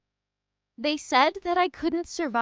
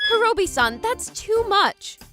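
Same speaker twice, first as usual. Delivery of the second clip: astonished protest; 6 semitones higher, 5 dB louder than usual. Acted. Girl to boy buying her several dresses, astonished. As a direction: protest in amazement. Protesting his generous purchases.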